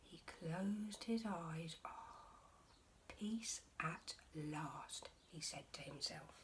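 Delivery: slow hushed whisper; soft, relieved whisper; sleepy whisper. A narrator's reading. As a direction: breathe it out as he drifts off. Bear finally falling asleep.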